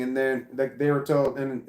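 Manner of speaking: normal talking voice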